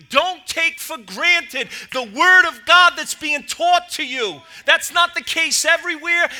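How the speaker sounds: loud, urgent exhortation; full-force preaching. Acted. Pastor urging young people in church, loud and urgent. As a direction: press the message on the youth.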